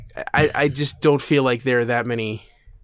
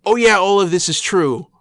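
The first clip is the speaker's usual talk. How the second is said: mock lecture; 5 semitones higher, 3 dB louder than usual. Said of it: "to go to Charlotte".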